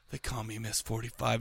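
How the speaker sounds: very low, soft mock threat; understated tough-gunslinger line; low menace. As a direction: drop it down low and quiet. Gunwoman's imagined line before shooting.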